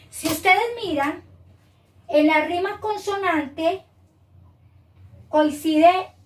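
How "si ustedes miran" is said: ordinary talk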